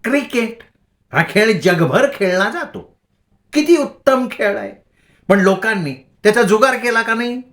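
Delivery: high, wild preachy voice; crazed lecturing. A narrator's reading. Bhagi ranting to the boys about gambling, high and wild. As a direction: rant like a madwoman, pitch jumping. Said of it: "तिने वेड्यागत हसत".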